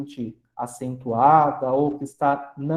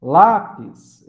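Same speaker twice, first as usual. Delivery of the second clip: "Lápis" with LÁ higher and much louder; stress on LÁ; 5 semitones higher, 10 dB louder than usual. Shown as a demonstration. Teacher showing class the tonic syllable, stressed LÁ in "Lápis". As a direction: punch the first syllable of "Lápis". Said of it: "o lá é a sílaba tônica".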